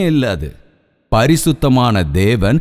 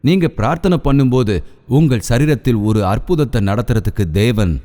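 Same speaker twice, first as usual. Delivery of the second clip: usual speaking voice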